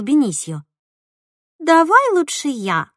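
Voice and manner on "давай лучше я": slow, high, languid sultry offer. Acted. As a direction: purr it out slowly, teasing. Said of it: "томным голосом предложила Марина".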